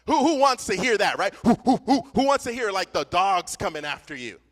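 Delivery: preacher pressing the congregation; emphatic loud question. Real, not acted. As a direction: hammer the who again and again. Preacher builds, repeats who.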